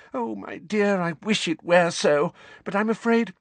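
put-on high, sorry old lady voice; regretful old woman. A narrator's reading. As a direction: break the bad news gently. Figg sorrowful.

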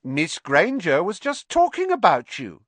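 high, loud sly tale-telling; impish, needling sing-out. Acted. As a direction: tell on someone with sly relish. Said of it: "said Peeves slyly".

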